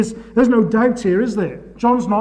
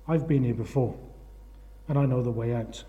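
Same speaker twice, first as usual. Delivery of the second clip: softer and lower, gently reassuring; calm reassurance; 6 semitones lower, 9 dB quieter than usual. Retold or imitated. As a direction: quietly reassure the man in the hole. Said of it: "To which Joe replied".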